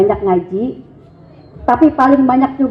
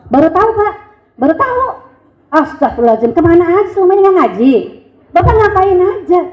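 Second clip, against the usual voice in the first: mock amazement; 7 semitones higher, faster than usual. Wife feigns amazement at husband.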